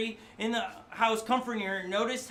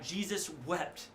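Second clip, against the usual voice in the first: solemn; 4 semitones lower, 7 dB quieter, slower than usual. Acted. Reading the shortest verse solemnly.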